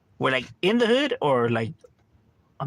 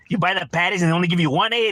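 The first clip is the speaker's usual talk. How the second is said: ordinary delivery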